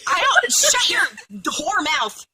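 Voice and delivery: high, loud fake-indignant yell; playful shrieking scold; mock outburst. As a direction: snap at your friend in mock outrage.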